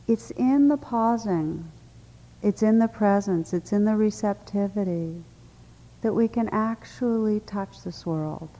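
normal talking voice

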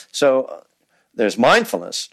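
ordinary delivery

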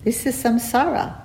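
put-on high, light Indian accent; a gentle Indian teacher's accent. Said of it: "in his beautiful Indian accent".

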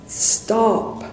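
a high, sharp mock shout of "Stop!". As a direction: call out "Stop!" after someone walking away. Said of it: "he shouts".

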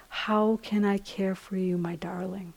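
soft, simple, tender voice; Thich Nhat Hanh. Retold or imitated. Teacher quoting a beloved master's caring question, tenderly. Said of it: "this beautiful, simple voice of like".

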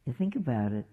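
ordinary talk

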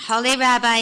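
narrated cry of appeal. A narrator's reading. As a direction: cry out to a holy man in distress. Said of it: "he cried".

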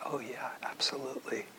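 quick breathy whisper of agreement; hushed eager yes. Retold or imitated. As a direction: whisper it fast, keen to agree. Retelling his eager answer to teacher.

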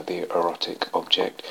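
ordinary talk

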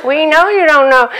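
loud, high, laughing disbelief; exasperated aunt. Retold or imitated. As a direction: spell it out to the stubborn kid. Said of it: "It's like".

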